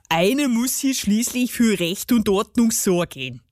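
self-important gnome character voice; grumpy garden gnome. A narrator's reading. Gnome justifies himself to the girl, self-important. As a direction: play a huffy little gnome.

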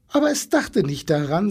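usual speaking voice

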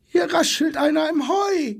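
high, alarmed zebra voice; frightened zebra. A narrator's reading. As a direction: cry out in alarm at the rustling. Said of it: "schrie in diesem Augenblick das Zebra". (hohe, aufgeregte Zebrastimme)

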